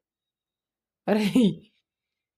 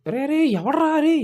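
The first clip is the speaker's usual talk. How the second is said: slow, much higher, loud shout; drawn-out yell; 10 dB louder, slower than usual. Real, not acted. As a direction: call out in alarm, stretching each word. Gamer yelling while getting shot. (సాగదీసిన అరుపు)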